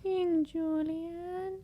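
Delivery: high, very slow squeaky cartoon voice; Mort impression. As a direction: draw out the name like Mort. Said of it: "My Mort impression".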